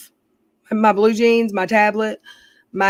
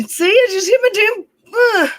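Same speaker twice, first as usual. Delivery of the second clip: yelped out much higher and louder; sudden pain; 13 semitones higher, 4 dB louder than usual. Real, not acted. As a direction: jolt as the elbow hits. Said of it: "I just hit my elbow".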